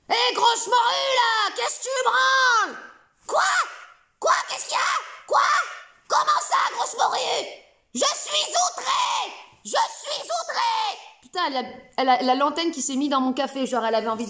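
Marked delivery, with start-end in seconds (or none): 0.1-2.8 high, slow, put-on bellow at the bestiole; rude coach yelling. Exit coach insults the sleeping bestiole, high hammed-up yell. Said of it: "il arrive et il dit". 3.3-11.0 very high, slow, squealing indignant creature voice; outraged bestiole. Acted. Insulted bestiole protests to the coach, high outraged squeal.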